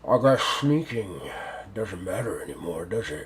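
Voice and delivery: low, soft octopus villain voice; octopus creature. Acted. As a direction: speak quietly and low as the lurking octopus. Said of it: "and he goes".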